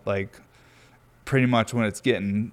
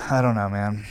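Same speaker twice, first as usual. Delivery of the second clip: ordinary talk, nothing marked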